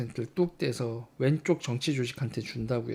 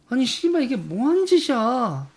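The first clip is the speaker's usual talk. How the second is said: sudden furious cry, much higher and louder; outraged outburst; 9 semitones higher, 6 dB louder than usual. Retold or imitated. Recalling his visit to Gwangju, a furious shout. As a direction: blurt it out in disbelief and rage. Retelling shock at Gwangju spending.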